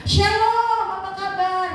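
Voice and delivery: a light mock of a father blessing his kids; lightly voiced elderly father; father's cheerful greeting. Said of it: "tanya dari saya".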